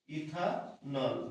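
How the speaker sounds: Ethanol stretched very slowly, syllable by syllable; slow spelled-out answer. Shown as a demonstration. Teacher slowly pronouncing ethanol for the class. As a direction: draw out ethanol slowly so students catch it. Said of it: "কি এটা?".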